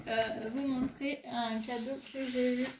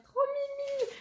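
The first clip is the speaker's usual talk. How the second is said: squealed high with delight; gushing; much slower than usual. Real, not acted. A friend gushing over a gift, squealing high. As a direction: trop mimi squeaked up high, so cute.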